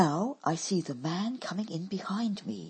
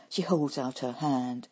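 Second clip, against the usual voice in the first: ordinary delivery, nothing marked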